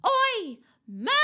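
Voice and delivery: big, high bellowed call. A narrator's reading. Poltergeist summons the ghost across the room, bellowing. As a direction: take a deep breath and bellow it out. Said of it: "took a deep breath and bellowed".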